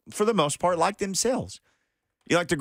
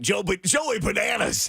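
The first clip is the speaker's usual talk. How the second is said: wacky DJ name; 7 semitones higher, slower than usual. Pretend. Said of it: "wacky F M D J voice".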